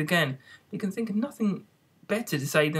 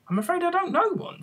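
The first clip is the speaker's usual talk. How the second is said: very high, alarmed reply; startled girl's reply; alarmed Alice. A narrator's reading. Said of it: "rather alarmed at the proposal".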